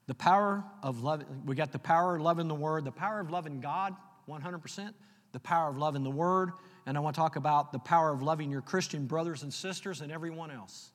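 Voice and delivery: fiery preacher's refrain; preaching at full force. Acted. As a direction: drive it home to the congregation. Preaching climax.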